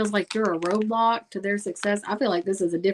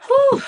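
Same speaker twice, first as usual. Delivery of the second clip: loud whew; 8 dB louder than usual. Real, not acted. Reacts to the cards.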